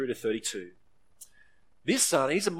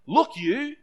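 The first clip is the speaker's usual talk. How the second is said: contemptuous; 9 semitones higher, 5 dB louder than usual. Acted. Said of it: "He's full of contempt".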